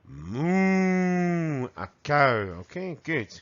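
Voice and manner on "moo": higher, long drawn-out moo; cow moo. Shown as a demonstration. Teacher imitating a cow for the class. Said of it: "What does a cow say?".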